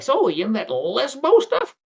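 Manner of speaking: high, loud, slow put-on question; eager vendor. Steve shows James his portfolio, eager vendor.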